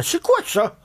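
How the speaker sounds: high mock puzzled listener asking. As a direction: squeak out the audience's confusion. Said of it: "Là, je vous entends".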